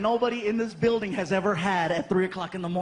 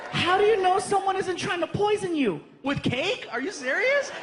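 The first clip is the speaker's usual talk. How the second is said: put-on girlfriend yelling; 9 semitones higher than usual. Girlfriend arguing about poisoned cake, high mock yell. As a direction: yell it like she can't believe him.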